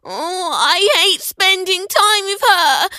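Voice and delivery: put-on high, slow whining boy; whiny protest. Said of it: "protested Ben".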